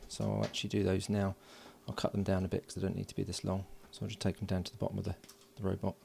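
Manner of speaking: ordinary delivery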